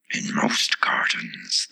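very low, slow whispery voice; explaining Tiger Lily. A narrator's reading. The Tiger Lily explains to Alice, low and slow. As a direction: explain deep and slow, all breath.

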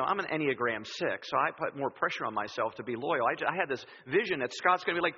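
usual speaking voice

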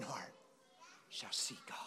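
soft breathy whisper on the last words; whispered scripture. Acted. Preacher whispering to the congregation, shall see God. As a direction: hush it like a secret.

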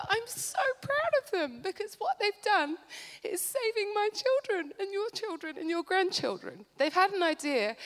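fighting back tears while praising them; crying with pride, voice cracking high; tearful, voice breaking. Real, not acted. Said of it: "I always flip and cry up here".

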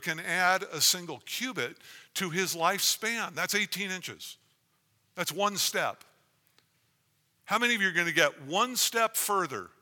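fervent full-voiced sermon; preaching.

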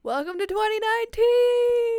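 high, loud, slow sarcastic announcement; mock cheer. Friend hosting a mock celebration, loud cheer. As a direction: hype it up ironically.

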